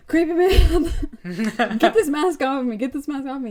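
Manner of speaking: hammed-up desperate demand; girl bursting into the shop, frantic; mock frantic plea.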